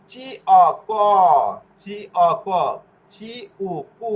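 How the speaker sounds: চি, অ, then a stretched ক with a hard k, likewise কু; hard C drill. Shown as a demonstration. Teacher drilling the k rule, drawn-out spelling of ক and কু. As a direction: chant the letters apart, drawing out ক and কু. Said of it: "চি অক্ষরের উচ্চারণ ক এর মতো হবে".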